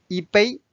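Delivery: 一杯 with 一 in the falling fourth tone. Shown as a demonstration. Teacher points out the tone change in 一杯, fourth tone on 一. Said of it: "Notice it's a fourth tone".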